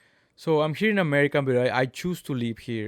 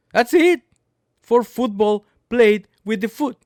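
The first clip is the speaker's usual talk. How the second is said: emphatic outburst; 7 semitones higher, 7 dB louder than usual. Real, not acted. Emphatic punchline on football.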